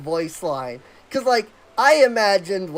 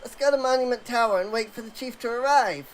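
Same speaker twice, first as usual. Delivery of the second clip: slightly higher, eager rambling sidekick; lightly voiced nervous sidekick recounting an escape; relieved Wedge; 5 semitones higher, 4 dB quieter than usual. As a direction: tell the tale breathlessly, relieved to be found. Reading Wedge's game dialogue.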